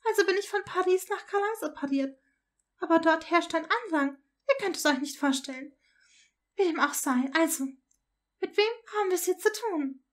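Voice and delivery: high-pitched mother, worn out and chatty; exasperated mother. Acted. Mother recounting her dreadful journey home, exhausted and exasperated. Voices Draco's mother in dialogue.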